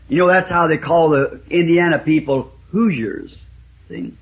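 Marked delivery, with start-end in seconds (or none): none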